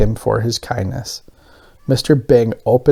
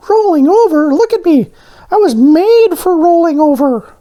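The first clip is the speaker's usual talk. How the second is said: squeaky high cartoon voice; bright little character voice, pitched way up; high donut voice; 5 dB louder than usual. A narrator's reading. Voices Arnie.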